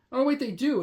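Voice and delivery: ordinary delivery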